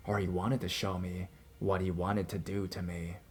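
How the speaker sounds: ordinary delivery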